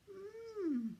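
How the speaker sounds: high curious hum copied by the elves; curious hmm. Acted. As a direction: echo Santa's hmm. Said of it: "the elves repeated".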